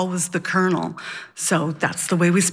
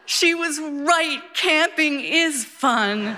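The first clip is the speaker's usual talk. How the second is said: high, slow, drawn-out delight; elated conclusion, stretched out and pitched up; happy triumph; 6 semitones higher, slower than usual. Acted. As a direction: savour every word with childlike glee. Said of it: "I feel happy".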